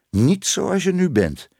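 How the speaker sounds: high-pitched indignant cry; calling out. A narrator's reading. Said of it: "riep tante Moortje". (uitroepend, met hoge stem)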